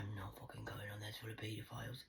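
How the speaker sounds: a fierce swearing whisper; whispered refusal. Retold or imitated. Refusing her dad's Facebook idea in a whisper. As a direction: spit the no out under your breath.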